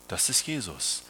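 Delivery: normal talking voice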